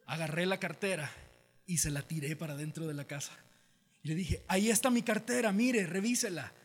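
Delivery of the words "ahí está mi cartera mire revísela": raised, louder pleading voice; acted desperate appeal. Throwing his wallet inside as proof, loud urgent appeal. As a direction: insist they check for themselves.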